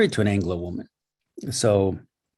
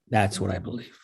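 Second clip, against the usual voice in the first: ordinary delivery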